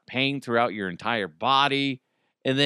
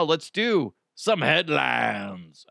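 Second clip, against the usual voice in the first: drawn-out, goofy segment intro; silly, slow headline lead-in; stupid-sounding announcement; much slower than usual. Real, not acted. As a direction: stretch the words out stupidly. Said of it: "I said that's stupid".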